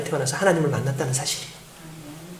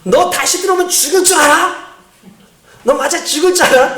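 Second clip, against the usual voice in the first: high-pitched, acted father's threat; angry threat; 9 semitones higher than usual. Father threatens the child who is leaving, acted angry warning. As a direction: threaten like a furious dad, retold with force. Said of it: "그 순간에 아버지가 뭐라고 얘기했냐면".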